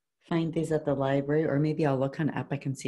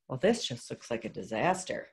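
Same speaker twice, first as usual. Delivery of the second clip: ordinary delivery, nothing marked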